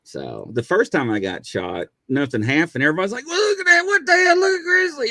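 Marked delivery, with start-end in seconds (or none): 3.3-5.1 high, loud astonished shout; amazed shout. Retold or imitated. Coworkers watch him shrug off taser, high amazed shout. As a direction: gasp out loud in disbelief.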